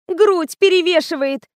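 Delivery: high-pitched sulky complaint; обиженное сопение. A narrator's reading. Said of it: "засопела Катя". (обиженная жалоба высоким голосом)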